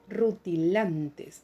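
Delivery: "Rutilantes" slowly, stressed on LAN. Shown as a demonstration. Teacher finding the tonic syllable, "Rutilantes" slow with weight on LAN. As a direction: draw out "Rutilantes", leaning on ru-ti-LAN-tes. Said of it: "sílaba tónica".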